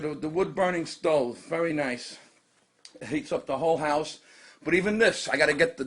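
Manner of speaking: ordinary delivery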